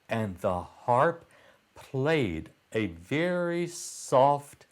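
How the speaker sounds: usual speaking voice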